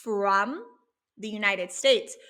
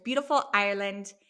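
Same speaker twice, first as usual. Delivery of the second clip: usual speaking voice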